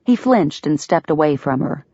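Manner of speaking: plain reading voice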